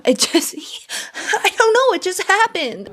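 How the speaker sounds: mocking giggle, playing the innocent; laughing mock excuse. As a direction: squeak it out through a laugh. Imitating Tyler's excuse, laughing.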